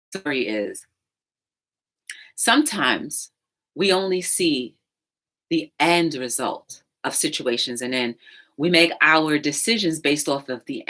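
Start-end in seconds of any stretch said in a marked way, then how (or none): none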